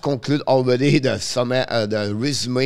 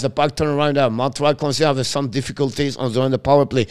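ordinary talk, nothing marked

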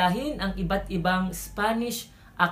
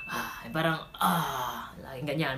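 tired; 4 semitones lower, 6 dB quieter, slower than usual. Shown as a demonstration. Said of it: "kailangan mukha kang pagod na pagod".

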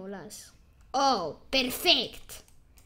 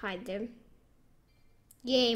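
ordinary talk

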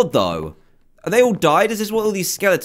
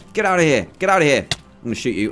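usual speaking voice

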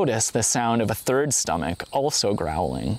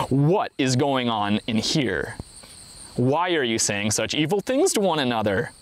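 ordinary delivery, nothing marked

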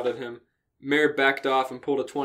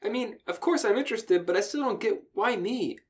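Anne voice; 9 semitones higher than usual. Acted. Reading Anne's line aloud.

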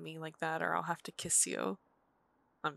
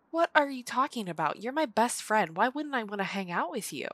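louder, higher, insistent; emphatic protest; 4 semitones higher, 6 dB louder than usual. Acted. Telling the friend she's her best friend, loudly insistent.

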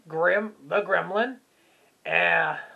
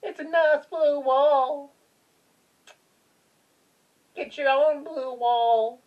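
cutesy mock scolding; 7 semitones higher, 4 dB louder than usual. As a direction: hold it high and stretch the words. Playful cutesy retort about wall.

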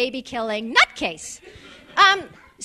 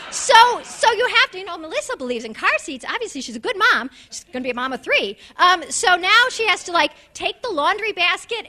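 usual speaking voice

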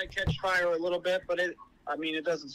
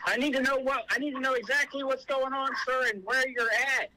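high, insistent raised voice; urgent demand; 7 semitones higher than usual. Real, not acted. Officer questioning screaming caller, urgent and insistent. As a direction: press hard for information over screaming.

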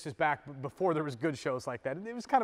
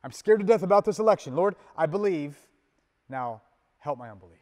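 louder, quick, frightened outburst, then calm; anxious prayer; 9 dB louder, faster than usual. Shown as a demonstration. Pastor voicing a voter's election panic, fearful and loud. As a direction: blurt the fear, then settle. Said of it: "you even need to say it right now".

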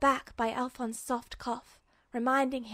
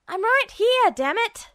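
high, loud indignant yell; fuming shout; 9 semitones higher, 9 dB louder than usual. A narrator's reading. Edward yells at the policeman who looked over him, furious. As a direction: snap back at being overlooked. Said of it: "Edward fumed".

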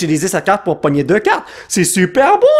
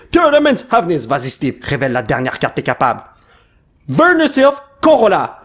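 ordinary talk

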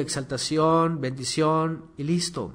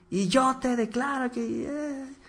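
mock preacher declaring; 10 semitones higher than usual. The pastor imitates preachers shouting at God before crowds, in a high mock declaring voice. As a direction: parody a microphone preacher's pronouncement. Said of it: "les grita y con el micrófono ahí en multitudes y le gritan así".

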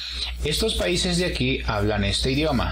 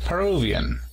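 "Peruvian" as puh-ROO-vee-an, higher on ROO; stress on second syllable; 5 semitones higher than usual. Shown as a demonstration. Teacher models the word for peruano, "Peruvian" stressed on second syllable. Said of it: "se encuentra en la segunda sílaba".

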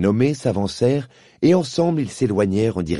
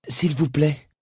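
stammering timidly; 5 semitones higher, slower than usual. A narrator's reading. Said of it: "balbutia timidement Diggory".

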